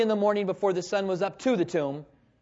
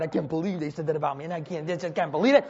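mock grumbling; 3 semitones lower, faster than usual. Mimics himself stewing on bike ride.

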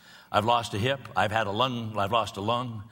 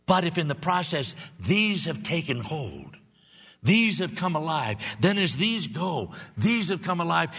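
higher, slow, weighty preaching; slow fervent preaching; 7 semitones higher, slower than usual. Acted. Pastor promising new life, in slow fervent preaching. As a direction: lay each phrase down slowly with fervor.

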